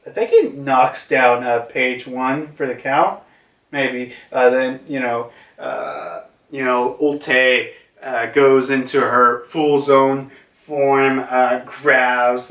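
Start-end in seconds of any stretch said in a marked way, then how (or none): none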